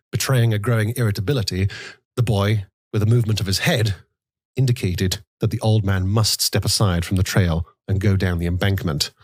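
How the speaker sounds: ordinary delivery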